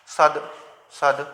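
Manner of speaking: "सद" repeated low, each ending on a voiced d; separate, low "सद", throat buzzing on the d; voiced final द. Shown as a demonstration. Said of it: "सद बोल रहे हैं तो आपकी स्वर तंत्रियों में कंपन".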